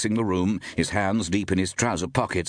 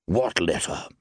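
ordinary delivery

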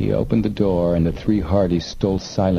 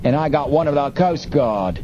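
boastful smuggler; 6 semitones higher than usual. A narrator's reading.

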